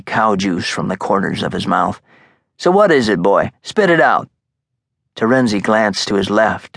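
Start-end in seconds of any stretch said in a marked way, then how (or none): 2.6-4.3 higher, louder scoffing mob boss; mocking fat gangster voice; amused Brooklyn mobster. Marcosa again.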